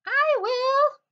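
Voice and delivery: very high, loud mouse voice; eager mouse cry. A narrator's reading. Mouse jumps at the offer of cake, very high eager cry. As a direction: squeal it out as an eager little mouse. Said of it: "cried the mouse".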